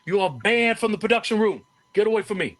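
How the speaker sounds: Dusty banishing him from the production room, yelling; full-throated yell. Retold or imitated. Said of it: "just full-throated".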